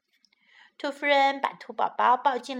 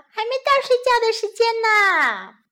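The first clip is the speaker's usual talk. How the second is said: put-on high, loud childish refusal; child refusing; 11 semitones higher, 8 dB louder than usual. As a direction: refuse bed like a stubborn toddler.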